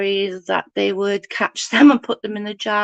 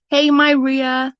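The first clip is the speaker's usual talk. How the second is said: normal talking voice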